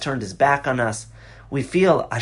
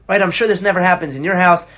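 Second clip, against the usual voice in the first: normal talking voice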